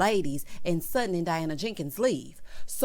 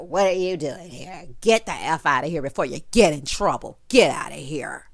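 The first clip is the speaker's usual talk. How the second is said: mock drunk Erica; 5 semitones lower, 4 dB louder than usual. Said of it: "Erica's like".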